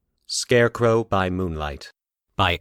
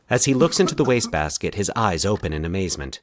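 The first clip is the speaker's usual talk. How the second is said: ordinary delivery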